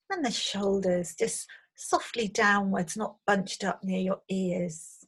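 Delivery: guided meditation. Acted. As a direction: gently guide them into settling, leaving space. Leads into the meditation.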